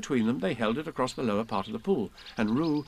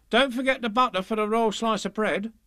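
dairymaid voice; 10 semitones higher, 4 dB louder than usual. Acted. Dairymaid to cow.

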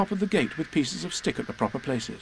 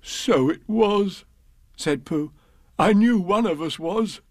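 lightly voiced Pooh; 7 semitones higher, slower than usual. Voicing Pooh.

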